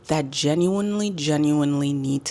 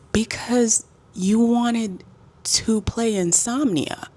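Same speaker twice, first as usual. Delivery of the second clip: slow, higher, drawn-out comeback; pointed retort; 6 semitones higher, slower than usual. Retold or imitated. Tired traveler to noisy roommate, slow pointed retort. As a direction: spell out the blame slowly, pitched up.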